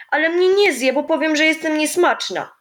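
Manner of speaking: put-on defiant little girl. A narrator's reading. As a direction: brave it out against the bear joke.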